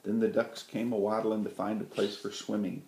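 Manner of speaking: usual speaking voice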